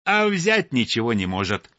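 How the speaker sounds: ordinary delivery, nothing marked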